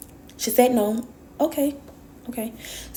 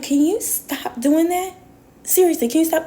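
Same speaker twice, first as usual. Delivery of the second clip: exasperated plea; 9 semitones higher than usual. Retold or imitated. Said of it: "And I'm like".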